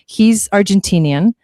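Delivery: ordinary delivery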